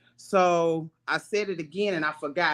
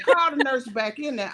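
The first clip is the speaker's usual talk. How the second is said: mock nurse command; 8 semitones higher than usual. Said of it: "She said".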